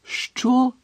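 high-pitched, startled yelp; stunned yelp. A narrator's reading. Ron and Hermione react to Harry's plan, stunned yelp. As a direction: yelp it high, utterly taken aback. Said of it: "ошелешено зойкнули Рон і Герміона".

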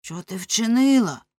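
loud, drawn-out demand; crying out. A narrator's reading. Husband demanding what his wife did, crying out. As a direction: cry out the question, high and slow. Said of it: "крикнув Ламе".

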